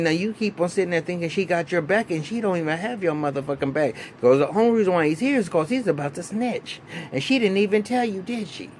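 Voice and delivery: lightly voiced lawyer taunting Ghost. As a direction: needle him that she kept secrets. Lawyer taunting Ghost about Angela.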